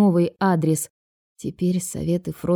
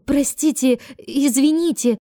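high-pitched, embarrassed apology; apologise, mildly panicked; flustered babble; 7 semitones higher, slower than usual. A narrator's reading. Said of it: "залепетала она". (смущённый лепет)

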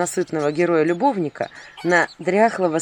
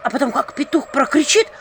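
frightened whisper; 4 semitones higher than usual. Acted. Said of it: "в ужасе пошептала баба".

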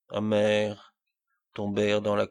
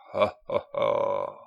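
royal laugh; 12 semitones lower, slower than usual. A narrator's reading. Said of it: "chorus au rire royal".

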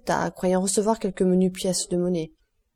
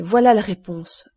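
louder cry of discovery; exclamation; 6 dB louder than usual. A narrator's reading. Count finding his answer in the abbé's manuscript, exclaiming. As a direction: exclaim it with conviction. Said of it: "s'écria-t-il".

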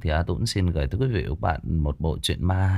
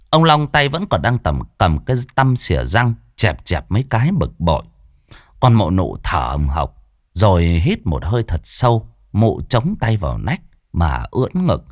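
louder, lively horror-story telling; story narration; 6 dB louder than usual. A narrator's reading. Narrator telling the ghost story to listeners, lively and loud. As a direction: narrate the night scene with energy.

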